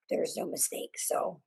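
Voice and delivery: "There's no mistake" breathed out as a soft unvoiced whisper; real whisper. Real, not acted. A mother recalling the arrest call, whispering "There's no mistake". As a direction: let "There's no mistake" fall to a whisper, almost no voice.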